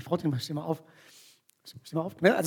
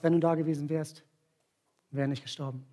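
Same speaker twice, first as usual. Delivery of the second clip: without reproach; 4 semitones lower, 5 dB quieter than usual. Retold or imitated. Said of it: "es kommt kein Vorwurf von ihr".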